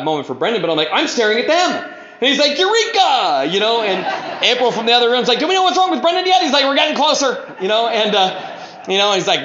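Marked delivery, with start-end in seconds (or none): 0.9-1.9 high-pitched dawning discovery; acted realization. Telling his sponsor what he finally saw, amazed. 2.6-3.5 high exclamation of discovery; mock triumph. Sponsor hailing his sponsee's breakthrough, exultant. 5.4-6.4 very high, quick call from another room; imitated wife calling. Sponsor's wife from the other room, calling out. 6.6-7.5 high sing-out to the next room; acted calling back. Sponsor answering wife in other room, calling.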